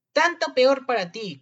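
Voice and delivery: slow, high, loud taunt; defiant retort. A narrator's reading. Boy answers the scolding wolf, loudly defiant. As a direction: throw it back at full volume.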